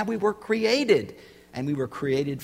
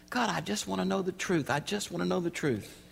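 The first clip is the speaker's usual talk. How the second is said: quiet prayer; 4 semitones higher, 6 dB quieter than usual. Retold or imitated. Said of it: "I can remember saying".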